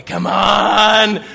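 root for them; urging cheer. Acted.

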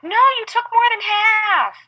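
high, loud indignant yell; girl yelling. Retold or imitated. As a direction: protest about the food. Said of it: "so they're like".

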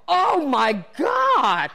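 slow, high, loud mock agony; pained outcry. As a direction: wail it as you hit the seat. Lands hard on painful seat.

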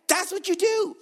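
high, punchy exclamation; emphatic. Real, not acted. Preacher backing the soldier's threat to the curser, emphatic. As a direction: burst out in emphatic approval.

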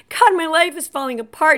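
loud, high-pitched overwrought outburst; mock meltdown. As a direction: act out losing it in traffic. Acted losing it in traffic.

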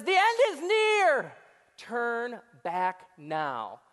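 mock doomsday warning; doom-laden sign. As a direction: intone it like a doomsday sign, dragging out turn back now. Pastors' sign read dramatically.

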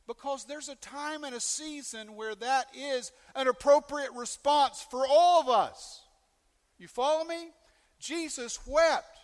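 preaching at full force. Acted. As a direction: preach it hard to the congregation.

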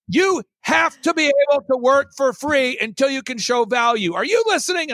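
high-pitched, hammed-up mentor tirade; theatrical berating. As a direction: lecture a pupil with mock theatrical force. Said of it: "he's very theatrical".

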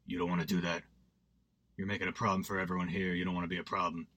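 quiet and low, letting each word sink in; low, soft Jigsaw voice calming a knife fight; Tobin Bell. Retold or imitated. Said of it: "Tobin Bell's like".

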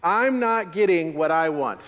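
high, loud, quick recital; loud call and response. Shown as a demonstration. Pastor leading the congregation in repeating the line, loud. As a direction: lead the congregation in saying it. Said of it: "You can say it with me".

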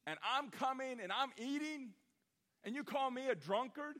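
Jesus scolding the crowd, exasperated; frustrated Jesus. Retold or imitated. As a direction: fling it back at the grumblers. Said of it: "what Jesus said, he goes".

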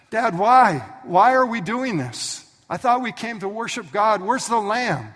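high, loud frightened cry; desperate boy. Retold or imitated. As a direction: plead in panic. Terrified Isaac pleading.

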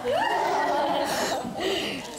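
high-pitched burst of laughter. Real, not acted. Laughs after kissing line.

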